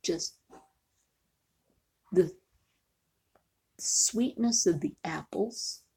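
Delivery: normal talking voice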